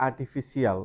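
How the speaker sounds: "artificial" ending "see-al"; common mispronunciation. Shown as a demonstration. Teacher showing how we say it, mispronounced. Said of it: "আমরা বলি".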